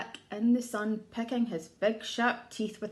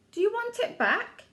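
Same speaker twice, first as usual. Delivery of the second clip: lightly voiced mouse; 9 semitones higher, 3 dB louder than usual.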